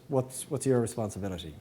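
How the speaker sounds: usual speaking voice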